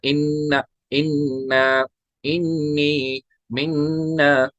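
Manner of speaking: इन्ना, इन्नी, मिन्ना with nasal nn held; nasal ghunna. Shown as a demonstration. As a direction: hum each doubled n. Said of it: "तो इनमें गुन्ना होगा". (गुन्ना के साथ मिसालें)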